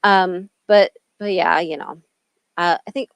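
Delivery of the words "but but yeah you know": normal talking voice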